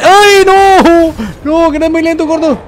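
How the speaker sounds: 2 high, loud cry of alarm; 3 squeal it like you're about to be caught; 1 panicked cry. Real, not acted. 4 player fleeing the fat enemy, high panicked cry.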